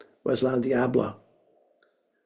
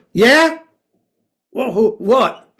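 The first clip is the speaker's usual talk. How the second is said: gruff phone answer; 8 semitones higher, 9 dB louder, slower than usual. Retold or imitated.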